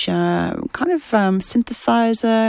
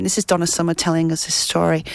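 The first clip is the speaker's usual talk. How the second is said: normal talking voice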